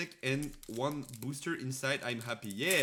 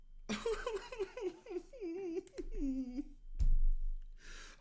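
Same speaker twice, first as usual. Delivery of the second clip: big laugh. Real, not acted. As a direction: laugh out at the bait. Bait card reveal.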